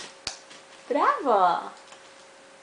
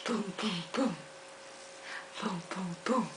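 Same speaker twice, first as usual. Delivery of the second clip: soft playful boom; 7 semitones lower, 17 dB quieter than usual. Acted. Play sounds for baby.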